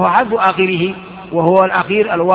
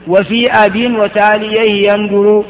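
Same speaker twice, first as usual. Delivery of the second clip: slow, measured verse recitation; reciting verse; 3 dB louder, slower than usual. Shown as a demonstration. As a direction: intone the line like memorized poetry. Teacher recites verse of poem.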